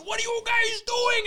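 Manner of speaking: high-pitched mock screech; stretched-up screamed question, acted; imitating screaming mom. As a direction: mimic a mother yelling an accusation. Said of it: "And she's scr".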